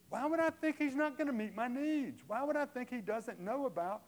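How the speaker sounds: loud, high fervent preaching; full-force preaching. Acted. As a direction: drive the point home to the congregation.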